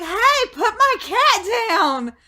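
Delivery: high, loud, drawn-out yell; freaking out. Retold or imitated. Owner yelling at aliens taking the cat, high frantic shout. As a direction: yell it in a high panic at the abductors. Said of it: "freaking out".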